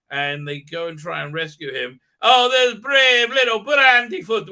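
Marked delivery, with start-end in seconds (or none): 2.2-4.5 loud, high, slow mock-Irish; big silly Irish accent; fake Irish accent. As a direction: bellow it in a hammed-up Irish lilt. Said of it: "with a fake Irish accent".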